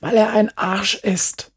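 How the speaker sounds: high, hissed accusation; irritated boy's hiss; angry hiss. A narrator's reading. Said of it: "zischte Markus".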